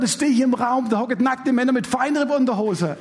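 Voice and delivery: usual speaking voice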